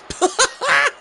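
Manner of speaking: embarrassed laugh. Real, not acted. Laughs after apologizing for screaming.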